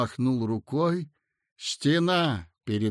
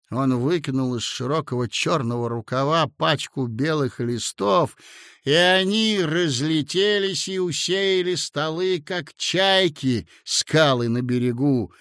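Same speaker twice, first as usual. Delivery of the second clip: ordinary delivery, nothing marked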